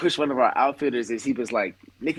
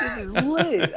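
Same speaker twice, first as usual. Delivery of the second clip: laughing; much slower than usual. Retold or imitated. Retells draft excitement, laughing.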